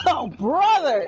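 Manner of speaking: slow laughing "oh brother"; laughing exclamation. Real, not acted. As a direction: laugh through the catchphrase. Catchphrase, laughing.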